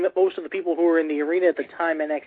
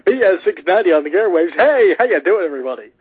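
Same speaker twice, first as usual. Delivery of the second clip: louder, upbeat AM radio host; 6 dB louder than usual. Pretend. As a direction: hype it like a morning DJ. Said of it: "That sounds like an A M radio station".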